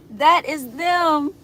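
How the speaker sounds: 천천히 크게 외치는 감탄; excited exclaim. Real, not acted. Excited reveal of nephews photo.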